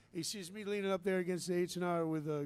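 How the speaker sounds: normal talking voice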